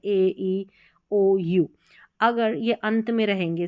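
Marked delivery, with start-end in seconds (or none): 0.0-1.7 ए, ई, ओ, यू as long alphabet names; letter-name sounds. Shown as a demonstration. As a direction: recite ए, ई, ओ, यू one by one as letter names. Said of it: "ये अपने नाम से बोल रहे हैं".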